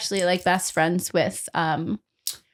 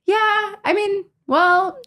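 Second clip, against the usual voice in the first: mock modest celebrity; 6 semitones higher than usual. Friend Paul revealing fame to fans, high coy voice. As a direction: play the humble celebrity, pitched up. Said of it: "takes his glasses off and he's like".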